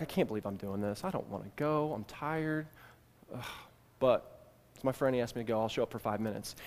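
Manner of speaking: soft tired grumble to yourself; grumbling to yourself. Retold or imitated. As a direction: grumble it under your breath, tired and unwilling. Grudging inner thoughts walking over.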